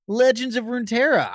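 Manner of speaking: high, loud teasing imitation; hammy parody of a limp intro; mock soft announcer. Shown as a demonstration. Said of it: "Give me a big old guttural Runeterra".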